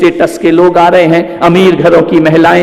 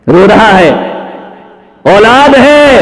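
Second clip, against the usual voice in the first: anguished cry; 6 semitones higher, 4 dB louder, slower than usual. Real, not acted. Lamenting lonely father.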